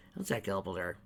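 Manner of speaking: ordinary delivery, nothing marked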